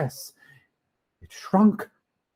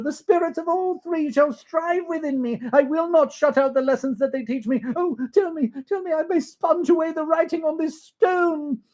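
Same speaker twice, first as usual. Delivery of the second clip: put-on high, pleading old miser; Scrooge pleading; 12 semitones higher, 5 dB louder than usual. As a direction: beg the spirit for mercy, voice raised. Scrooge vowing to spirit.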